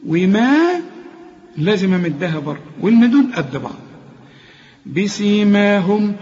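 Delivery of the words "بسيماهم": "بسيماهم" slow, lips closed firmly on the mim, then the long aa held; careful tajweed reading of "بسيماهم"; "بسيماهم" with the alif stretched. Shown as a demonstration. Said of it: "الميم اللي احنا بنقفل فيها شفايفنا".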